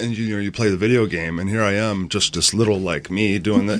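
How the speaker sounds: usual speaking voice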